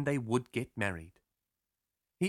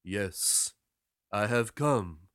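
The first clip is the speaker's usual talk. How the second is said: low, matter-of-fact sailor; sailor's reply; 5 semitones lower, much slower than usual. A narrator's reading. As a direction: answer her calmly in a lower voice. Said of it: "said the sailor".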